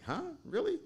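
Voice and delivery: high squeaky disbelieving huh really; surprised. Retold or imitated. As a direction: jump way up in pitch in disbelief. Retold disbelief on waking.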